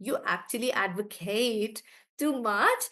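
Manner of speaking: "advocate" with a long final KATE; verb stress. Shown as a demonstration. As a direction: draw out the last syllable of "advocate". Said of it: "I'm stressing on the last part".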